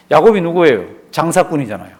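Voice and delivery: normal talking voice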